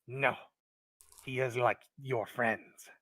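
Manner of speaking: loud, slow kobold with heavy pauses; distrustful kobold. Acted. Kobold describes the outcast to the adventurers, loud distrust. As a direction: drop each damning description separately and forcefully.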